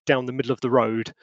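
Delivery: ordinary talk, nothing marked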